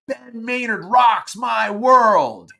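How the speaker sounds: high, slow, drawn-out radio contest shout; mock-ecstatic catchphrase, stretched out; hammed-up winner's cheer. Shown as a demonstration. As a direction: belt it out like a caller winning tickets. Models the winning caller's phrase.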